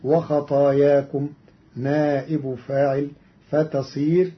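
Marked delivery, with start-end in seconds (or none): none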